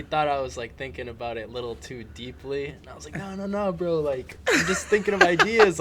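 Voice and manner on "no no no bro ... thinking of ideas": higher, louder mock protest; retold protest. Guest retelling how he reassured his overly worried friend, animated protest. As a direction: protest lightly, pitch climbing.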